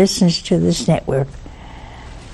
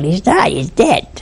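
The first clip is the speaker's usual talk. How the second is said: ordinary delivery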